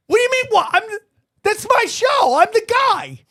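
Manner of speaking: very high-pitched, exasperated retort; incredulous. Retold or imitated. Host retelling how he told a fan it's his show, incredulous. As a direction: re-enact disbelief, voice shooting right up.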